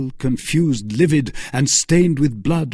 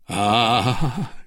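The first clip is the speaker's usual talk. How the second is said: crying out; 3 semitones higher, 3 dB louder, much slower than usual. A narrator's reading. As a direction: cry out as if stabbed. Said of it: "cried Buckingham".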